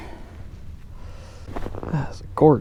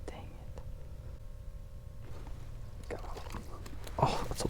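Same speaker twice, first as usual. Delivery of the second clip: whispering; 20 dB quieter than usual. Real, not acted. Whispered while fishing.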